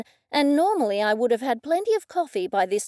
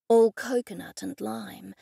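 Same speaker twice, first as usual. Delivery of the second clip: usual speaking voice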